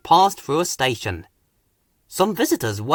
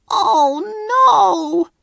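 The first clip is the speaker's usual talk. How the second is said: much higher, a crying moan; 4 dB louder than usual. A narrator's reading. Broken-down Thomas moans in despair, near tears. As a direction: wail in dismay. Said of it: "Thomas moaned".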